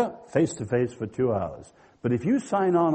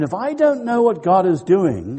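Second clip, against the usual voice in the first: ordinary delivery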